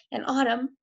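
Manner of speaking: usual speaking voice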